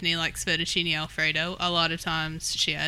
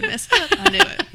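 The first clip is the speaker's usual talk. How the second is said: excited; 9 dB louder than usual. Real, not acted. Caught mispronouncing a word.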